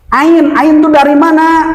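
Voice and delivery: ordinary delivery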